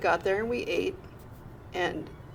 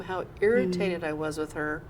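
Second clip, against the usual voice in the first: ordinary delivery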